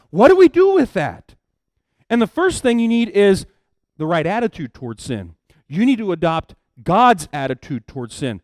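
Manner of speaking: preaching. Acted. Preacher urging the congregation to deal with sin, forceful. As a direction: hammer the point home to the congregation.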